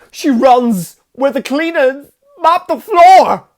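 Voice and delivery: high, slow, shouted psychic vision; Christopher Walken. Retold or imitated. Acting out Walken's psychic warning, shrieked in alarm. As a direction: shout it in escalating mock horror. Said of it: "He's like".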